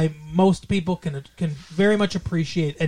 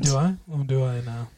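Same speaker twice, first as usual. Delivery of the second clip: mock seductive; 6 semitones lower, 5 dB quieter than usual. Plays up being told accent is sexy.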